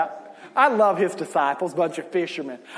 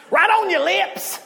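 mock scolding shout at a new believer; mock condemning preacher; 9 semitones higher than usual. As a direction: play the judgmental church elder yelling at a sinner, half laughing. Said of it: "they get told what they're doing is a sin".

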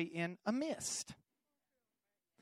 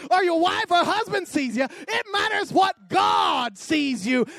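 full-force preaching; 8 semitones higher, 9 dB louder than usual. Acted. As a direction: preach it out loud to the whole church.

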